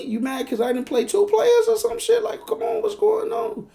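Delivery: very high squealed plea; panicked pleading. Retold or imitated. Man held hostage asking why, panicked pleading.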